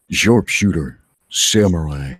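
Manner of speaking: slow, dramatic trailer narration with long pauses; brooding voiceover read; movie trailer voice. Acted. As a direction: sell the doom of it like a blockbuster promo. Second trailer pitch begins.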